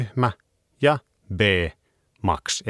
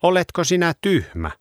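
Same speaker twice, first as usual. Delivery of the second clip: scolding; 10 semitones higher, faster than usual. A narrator's reading. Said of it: "taksi haukkui".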